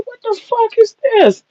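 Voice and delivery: retelling hearing the Cajun man in the elevator, shocked; shocked disbelief. Retold or imitated. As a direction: squeak it out like you can't believe your ears. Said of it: "I said".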